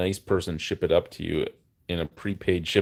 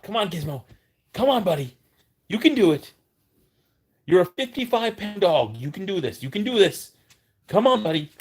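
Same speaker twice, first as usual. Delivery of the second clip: talking to a dog; 11 semitones higher, 5 dB louder than usual. Real, not acted. Coaxing dog to get up.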